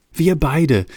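plain reading voice

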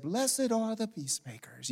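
slow, softly voiced scripture quote; mock a hushed, saintly Bible reading; gently drawn-out holy voice; soft, pious Jesus. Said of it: "you mouth it as though Jesus said".

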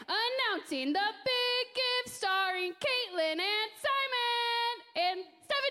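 slow drawn-out announcer voice; fighting game announcer. Pretend. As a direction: boom it out like a ring announcer. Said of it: "practicing my announcer voice".

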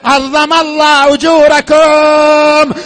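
ordinary talk, nothing marked

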